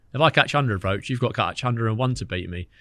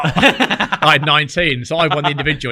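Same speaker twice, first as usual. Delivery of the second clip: laughing; 4 semitones higher, 7 dB louder than usual. Real, not acted. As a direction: savour the win through real laughter. Laughing as he tells winning.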